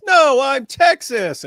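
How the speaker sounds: high, slow, loud cartoon sponge voice; high cartoon voice insisting on the joke; mock cartoon voice. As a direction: correct him with goofy pride. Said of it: "And he goes".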